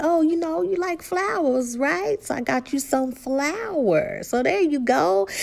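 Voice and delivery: high teasing mock voice; teasing husband. Husband teasing his wife about flour, high mock voice. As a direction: tease her like the joking husband. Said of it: "he's like".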